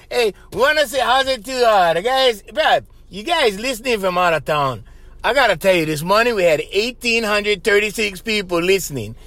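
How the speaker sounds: ordinary delivery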